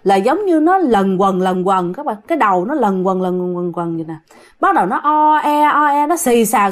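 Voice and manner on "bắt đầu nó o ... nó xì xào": eerie murmur. Retold or imitated. Eerie murmuring spirits around the son's head. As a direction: hum it like spirits circling the head.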